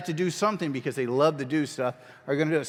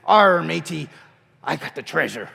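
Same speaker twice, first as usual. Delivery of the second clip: loud, hammed-up pirate line; 6 dB louder than usual. Shown as a demonstration. The teacher shows the kids a pirate line, hammed up and loud. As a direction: play it like a stage pirate. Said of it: "you can be the pirate".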